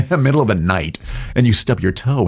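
usual speaking voice

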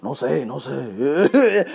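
lightly voiced low Mexican-accented warning; a hint of a Spanish accent, hushed. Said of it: "telling him in Spanish".

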